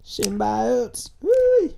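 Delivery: high whooping hillbilly call. Acted. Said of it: "in a redneck voice".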